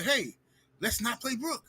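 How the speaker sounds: usual speaking voice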